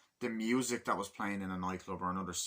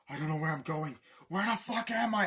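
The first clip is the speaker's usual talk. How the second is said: high, loud and lost; frantic yelling, pitched way up; panicked shout; 7 semitones higher, 5 dB louder than usual. Retold or imitated. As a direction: cry out in confused terror.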